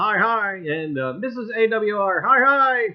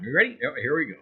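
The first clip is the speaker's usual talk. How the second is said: ordinary delivery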